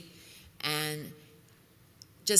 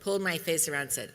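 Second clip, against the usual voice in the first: usual speaking voice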